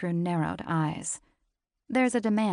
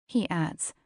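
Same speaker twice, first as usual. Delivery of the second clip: ordinary delivery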